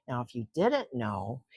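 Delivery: ordinary delivery, nothing marked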